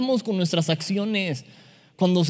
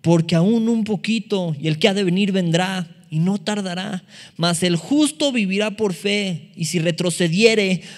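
forceful scripture proclaim; 2 semitones higher, 4 dB louder than usual. Acted.

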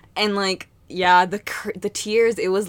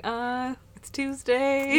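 higher, slow, drawn-out dazed answer; clueless reply; 5 semitones higher, 3 dB quieter, much slower than usual. Retold or imitated. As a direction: play someone too out of it to help. Acting out her clueless, drugged reply.

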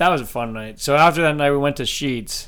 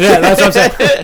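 laughing; 6 semitones higher, 8 dB louder than usual. Real, not acted. Laughing at the bag mix-up.

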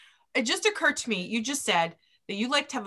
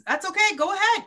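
higher-pitched, breezy go-ahead; easygoing permission; 8 semitones higher than usual. Retold or imitated. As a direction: imitate waving someone on casually. Voices reassurance to clients at dinner.

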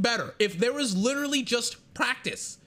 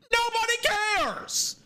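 drawn-out dismissal; 12 semitones higher, slower than usual. Real, not acted. Flat dismissal after mock reveal.

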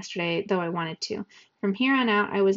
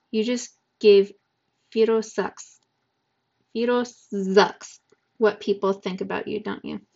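lightly voiced tough boy; 3 dB louder, slower than usual. Yasmani voiced, slow, different timbre.

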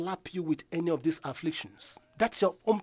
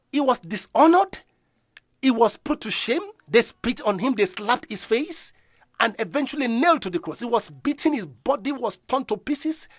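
fervent preaching; 4 semitones higher, 5 dB louder than usual. Acted. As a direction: lean hard on each blow. Preacher recounting the crucifixion.

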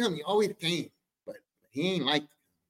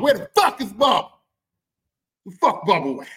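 barging in; 3 semitones higher, 7 dB louder than usual. Retold or imitated. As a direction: storm in yelling for him. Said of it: "stomped in like a big dog".

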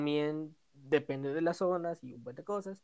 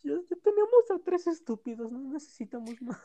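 indignant outburst; 8 semitones higher, 5 dB louder than usual. Real, not acted. Indignant about politicians.

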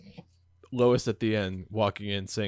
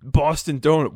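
higher mock Boston; Boston accent; 4 semitones higher, 4 dB louder than usual. Friend echoes the coffee offer, mock Boston accent. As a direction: play up the Boston accent, pitched up. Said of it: "can you do a Boston accent".

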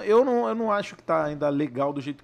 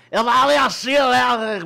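imitação acelerada, meio cheirada; voz aguda e bem alta, elétrica; Porchat; 6 semitones higher, 7 dB louder, slower than usual. Shown as a demonstration. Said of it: "Parecia um Porchat meio cheirado". (caricatura eufórica de humorista)

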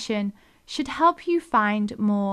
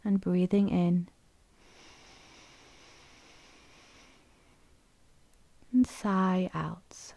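unhurried, even delivery, leaving room to breathe; guided meditation; 4 semitones lower, 4 dB quieter than usual. Acted. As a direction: guide them gently into stillness, phrase by phrase.

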